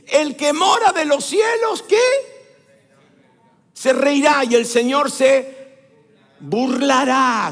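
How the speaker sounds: high, loud, slow scripture shout; shouted proclamation. Real, not acted. Preacher proclaims God's answer to congregation, shouted. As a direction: belt out the verse, stretching the key words.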